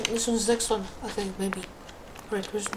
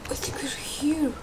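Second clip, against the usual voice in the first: hushed, breathy whisper; 8 dB quieter than usual. Real, not acted. Gamer whispering to viewers in a creepy village. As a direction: whisper so nothing hears you. Tense moment in horror game.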